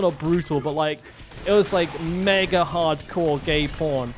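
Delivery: usual speaking voice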